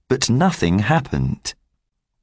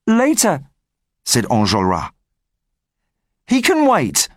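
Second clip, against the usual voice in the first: lightly voiced leader; 5 semitones higher than usual. Enjolras answers his men.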